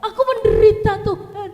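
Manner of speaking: high, loud hammed-up lament to God; mock wail. Preacher mocking a dumped lover crying out to God, loud hammed-up wail. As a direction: overplay the heartbroken cry. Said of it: "jangan ngomong".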